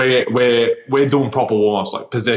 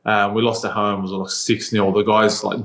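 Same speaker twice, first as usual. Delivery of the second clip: normal talking voice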